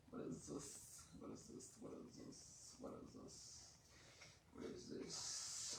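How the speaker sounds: very soft, whispered questioning; hushed repeated whisper; whispering to self. Real, not acted. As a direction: whisper it under your breath, barely audible. Muttering to self while cooking.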